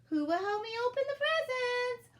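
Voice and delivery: very high, loud singsong sweetness; exaggeratedly sweet. A narrator's reading. Elf asking who will open presents, pointedly sweet. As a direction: lay the sweetness on thick, way up high. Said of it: "her voice was as sweet as ever".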